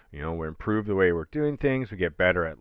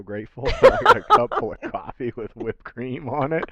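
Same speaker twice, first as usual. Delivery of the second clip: laughing; 3 semitones higher, 6 dB louder, faster than usual. Retold or imitated. Excited quote breaking into laughter.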